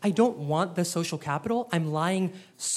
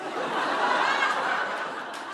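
high breathless laugh. Real, not acted. Laughing at own joke.

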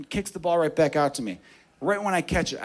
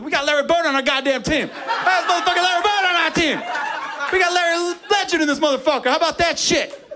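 hyped cheering; 13 semitones higher than usual. Retold or imitated. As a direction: shout it like the crowd's going wild. Team cheers the second shot.

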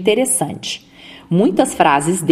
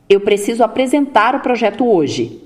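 firm, straight ending on "hoje", no questioning lift; firm statement; 3 semitones higher than usual. Shown as a demonstration. Speech coach models an affirmation for listeners, firm straight finish on "hoje". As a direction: state it with certainty, landing "hoje" firmly.

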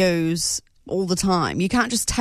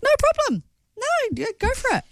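high, easygoing boss imitation; breezy boss voice; 14 semitones higher, faster than usual. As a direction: toss it off like a relaxed boss. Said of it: "he was like".

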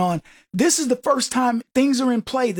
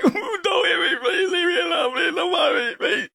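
high whimpering mock plea; mock pleading victim; 7 semitones higher than usual. Host mocking beaten Democrats begging for mercy, high mock whimper. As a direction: beg not to be hit, hammed up. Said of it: "They should be like".

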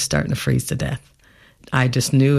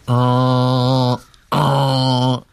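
long, level horn blast imitation; drawn-out monotone honk; imitated boat horn; 2 semitones lower than usual. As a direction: hoot like a boat's horn. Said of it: "I heard a wonderful sound".

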